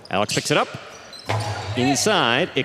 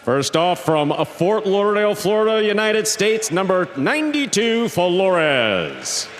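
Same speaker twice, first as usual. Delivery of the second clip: big ring-announcer delivery, high-pitched with drawn-out names; arena announcer; 7 semitones higher, slower than usual. Acted. Announcer introducing the teams to the fans, booming showman delivery. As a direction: stretch each player's name out like a proud arena announcer.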